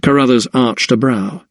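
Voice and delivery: ordinary delivery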